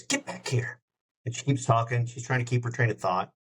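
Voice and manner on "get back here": higher, softer call away from the mouthpiece; off-mic call. Retold or imitated. Singer on a radio call calling her dog, off-mic. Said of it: "pulls her, uh, mouth away from the phone".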